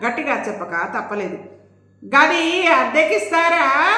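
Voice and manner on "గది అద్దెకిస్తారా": high, loud and slow call; shouting the question to the deaf-eared landlord; loud shouted question. Acted. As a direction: bellow the question over the din. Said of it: "మళ్ళీ గట్టిగా అడిగాను".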